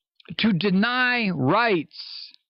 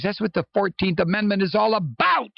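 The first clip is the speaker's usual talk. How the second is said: ordinary delivery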